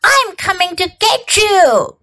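high, teasingly menacing call; storybook ghost on the attack; ghost's cry. A narrator's reading. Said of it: "cried a ghost".

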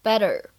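"Better" with the double t as a quick American flap. Shown as a demonstration. Teacher shows learners American "Better" with a flap T. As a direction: tap the tt in "Better", between t and d. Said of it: "是一个闪音".

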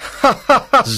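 high, hearty mocking laugh; loud hero laugh. A narrator's reading. Zorro laughs in the sergeant's face, loud and high. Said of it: "Zorro laughs loudly".

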